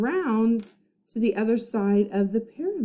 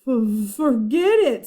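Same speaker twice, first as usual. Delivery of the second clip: stammering; 2 semitones higher, 6 dB louder, slower than usual. Acted. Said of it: "stammered Jack".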